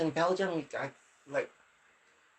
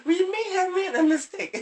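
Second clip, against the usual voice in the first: much higher, sheepish mock voice; higher-pitched mock admission of error; mock regretful quote; 11 semitones higher, 6 dB louder than usual. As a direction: play the sheepish fool admitting a mistake. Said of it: "And then you go".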